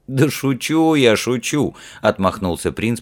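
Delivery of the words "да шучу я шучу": higher, drawn-out playful dismissal; amused brush-off. A narrator's reading. Prince reassuring Ralph he is joking, amused. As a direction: wave it away lightly, as a joke. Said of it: "по-прежнему смеясь".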